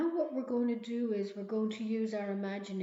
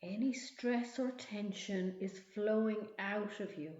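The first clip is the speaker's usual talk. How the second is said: guided meditation. Acted. Leads a relaxation visualization.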